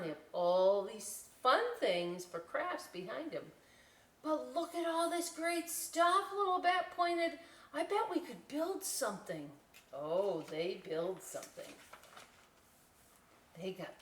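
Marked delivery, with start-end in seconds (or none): 4.2-9.5 higher voiced, eager; eager Little Bat. Little Bat urging friends to build, higher voice. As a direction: point out the craft stuff with excitement.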